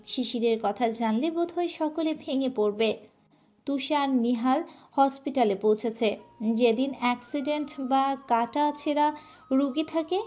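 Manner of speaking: ordinary delivery, nothing marked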